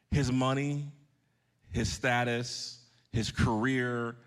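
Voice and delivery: each item spaced out and weighed; slow, weighty listing; slow and deliberate. Real, not acted. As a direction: lay out the list slowly for emphasis. Slow emphatic list of worldly things.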